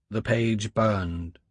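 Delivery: plain reading voice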